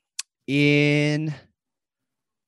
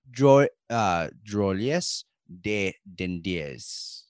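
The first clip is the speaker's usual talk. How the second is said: slow, softer French with a Spanish ending; Spanish-sounding French attempt; 6 semitones lower, 3 dB quieter, slower than usual. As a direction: try careful French, drift into Spanish. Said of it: "that sounded more Spanish at the end".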